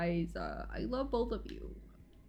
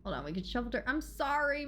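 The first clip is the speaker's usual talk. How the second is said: ordinary talk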